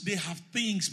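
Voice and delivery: ordinary talk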